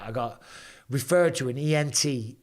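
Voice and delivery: usual speaking voice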